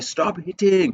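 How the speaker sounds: high, loud telling-off; cross command. Acted. Acting the unhelpful command.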